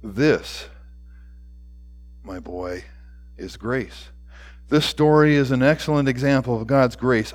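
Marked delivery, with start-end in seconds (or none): 0.0-4.1 low, hushed, tender close; softly. A narrator's reading. Principal kneels cradling the child's face, softly tender. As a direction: sink very soft, pausing between phrases. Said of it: "The principal softly says".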